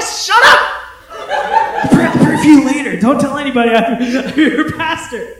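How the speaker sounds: high, loud, laughing hush; half beg, half laugh, fast; embarrassed pastor laughing as he pleads. Retold or imitated.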